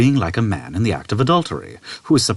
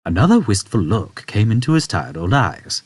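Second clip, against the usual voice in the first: ordinary delivery, nothing marked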